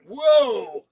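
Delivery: loud, high, stunned gasp of wonder; amazed whoa. Retold or imitated. Moviegoer seeing the starship on screen, loud amazed whoa. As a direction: let the starship blow you away. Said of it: "and I went".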